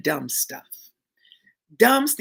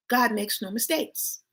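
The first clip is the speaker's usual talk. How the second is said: ordinary talk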